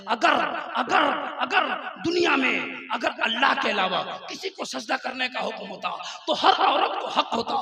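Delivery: loud, forceful sermon delivery; impassioned preaching. Real, not acted. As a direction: drive each phrase home at full volume. (पूरे जोश से बुलंद तकरीर)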